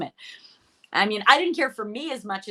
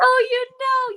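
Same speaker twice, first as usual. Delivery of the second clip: high, loud, eager oh; bursting to tell it; excited; 7 dB louder, slower than usual. Real, not acted. Guest eagerly starts her anthem story.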